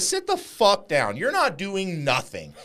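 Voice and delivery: loud, high, slowed-down order; forceful storyteller's imitation of a threat; retold angry command. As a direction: re-enact putting someone firmly in his place. Retold confrontation with the friend.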